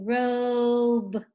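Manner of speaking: "robe" drawn out on a long, level o; slow sounding-out. Shown as a demonstration. Teacher repeats the last word for pupils, slow "robe". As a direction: hold the vowel in "robe". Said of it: "Make sure you say it slow".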